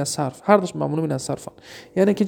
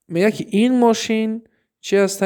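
ordinary delivery

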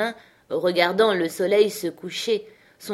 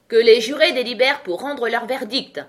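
loud, raised royal order; commanding; 4 semitones higher, 6 dB louder than usual. A narrator's reading. The king orders the jury to deliberate, commanding. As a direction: proclaim it to the courtroom. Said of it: "ordonna le roi pour la vingtième fois".

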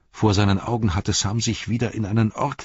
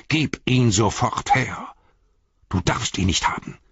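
strangely changed, demanding; 3 semitones higher than usual. A narrator's reading. Frodo to Sam over the Ring, suddenly fierce. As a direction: stretch the order out, fiercely possessive. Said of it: "Dann schlug sein Ton plötzlich sonderbar um".

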